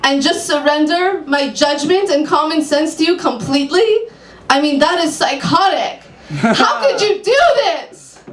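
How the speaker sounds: high, laughing, rising to a cry; outraged client. Acted. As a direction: confront her in disbelief. Client confronts psychic.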